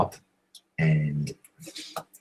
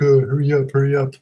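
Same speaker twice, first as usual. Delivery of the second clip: higher-pitched mimicked nagging; impatient, raised-pitch hurry-up call; calling out impatiently; 6 semitones higher, 4 dB louder than usual. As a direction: mimic her calling back to hurry someone along. Impatient date calls back to him.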